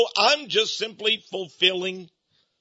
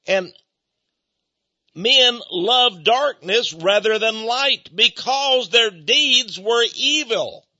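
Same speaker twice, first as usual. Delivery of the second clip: emphatic preaching; 3 semitones higher, slower than usual. Acted. Preacher pressing the verse on the congregation, slow and emphatic. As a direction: drive each word of the verse home.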